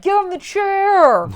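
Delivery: high, drawn-out heckler yell; mock crowd scream. Crowd member heckling the man being sentenced, mock screaming. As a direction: imitate an outraged yell from the crowd. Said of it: "screaming at him".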